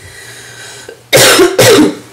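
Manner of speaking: long harsh smoker's cough; coughing fit. Real, not acted. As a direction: cough it out, wheezing. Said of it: "so I'm really wheezy".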